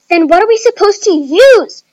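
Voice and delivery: high, loud exasperated shout; shrieked, fed-up demand; annoyed scream. A narrator's reading. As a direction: scream the question in irritation. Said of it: "screamed Nikki annoyingly".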